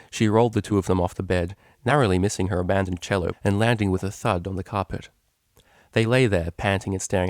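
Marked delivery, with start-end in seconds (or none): none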